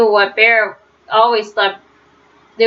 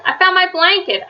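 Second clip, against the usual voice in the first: excited; 9 semitones higher than usual. A narrator's reading. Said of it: "said Franklin".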